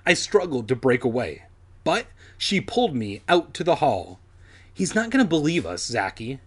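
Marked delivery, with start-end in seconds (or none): none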